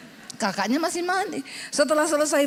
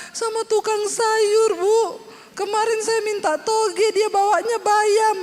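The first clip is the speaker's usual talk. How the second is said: complaining housewife; 7 semitones higher, 3 dB louder than usual. Retold or imitated. Menirukan ibu mengeluh tukang sayur.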